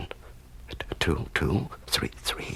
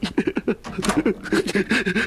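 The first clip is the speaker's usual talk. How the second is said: tearful laugh; 13 semitones higher than usual. Acted. Prisoner overcome with joy.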